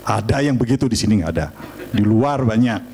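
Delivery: normal talking voice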